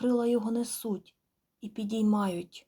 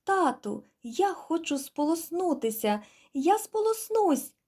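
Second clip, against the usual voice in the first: put-on small pleading child voice, rising high at the end; a little gosling begging its father to let it swim; gosling's voice; 8 semitones higher, 3 dB louder than usual. A narrator's reading. Gosling begging father goose to let it rinse, pleading child voice.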